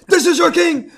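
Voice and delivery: high, loud movie-style proclamation; mock shout. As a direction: shout it high, laughing along.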